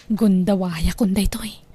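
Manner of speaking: hushed, sneaky whisper. Acted. Thief sneaking toward the madam's room, whispering. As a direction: whisper so no one in the house hears.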